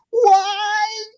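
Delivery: high, drawn-out "Why?" up to the heavens; anguished cry to the sky. Retold or imitated. Quoting Sinbad in Good Burger, high wail to the sky. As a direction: wail it at the sky like a movie scene. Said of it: "looks up to the sky and goes".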